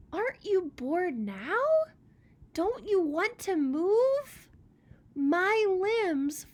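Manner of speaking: high, loud and pushing; eager pine. Acted. Tall Pine pestering Old Rock, high and eager. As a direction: coax him to get up and go.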